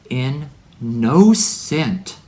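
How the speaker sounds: "in no cent" drawn out very slowly as three words; split the word. Shown as a demonstration. Preacher wordplay on "in no cent", very slow and split. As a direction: pull "in no cent" apart, lean on "no". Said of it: "listen to the word".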